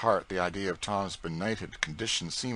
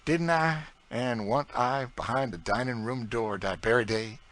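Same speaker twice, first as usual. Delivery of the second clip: put-on higher, louder proud old cook in broad dialect; Aunt Chloe's voice; 7 semitones higher, 4 dB louder than usual. A narrator's reading. Aunt Chloe boasting to young George, broad proud dialect. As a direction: boast with a scornful toss of the head.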